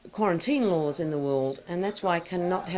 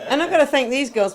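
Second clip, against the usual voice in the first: laughing; 6 semitones higher, 9 dB louder than usual. Real, not acted. Thanking friends, laughing.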